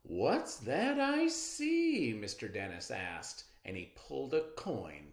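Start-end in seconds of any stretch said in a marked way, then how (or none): 0.0-2.1 high, slow teasing question; playful driver. A narrator's reading. Bus driver teasing the boy before a coin trick. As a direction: draw it out like a magic trick.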